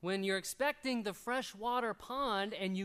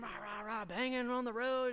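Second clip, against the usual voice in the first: imitated road rage; 4 semitones higher, 3 dB louder than usual. Road rage by the same hypocrite.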